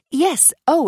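high jump of gladness on yes; a sudden happy rush; lifted, glad exclamation; eager relief. Acted. Relief hearing him on phone.

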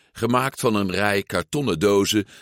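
usual speaking voice

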